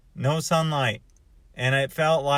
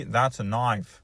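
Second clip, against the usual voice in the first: Crocodile Dundee; 5 semitones lower, slower than usual. Retold or imitated. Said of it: "maybe some Crocodile Dundee".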